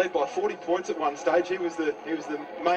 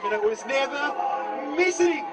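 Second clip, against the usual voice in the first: goal call yell; 9 semitones higher than usual. Real, not acted. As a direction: lift into a big goal yell. Goal kick sails through.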